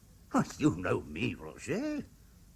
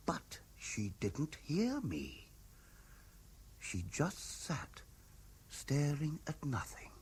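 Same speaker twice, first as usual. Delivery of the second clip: softer, slow, drifting recollection; husband recalls his wife's trance, hushed and slow; 5 semitones higher, 6 dB quieter, slower than usual. Acted. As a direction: draw it out softly as if reliving the eerie moment.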